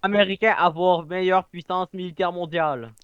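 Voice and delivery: put-on slow broken Russian accent; lightly put-on Russian accent. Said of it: "parler entre russe puis français".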